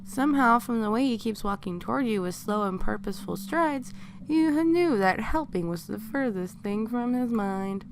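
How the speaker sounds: plain reading voice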